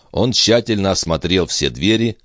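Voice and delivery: ordinary delivery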